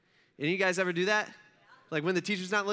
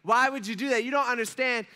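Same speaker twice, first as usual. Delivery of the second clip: louder, higher indignant protest; exasperated, raised-voice reproach; retold mad outburst; 6 semitones higher, 5 dB louder than usual. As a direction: complain hotly as the wronged brother. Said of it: "I was so mad".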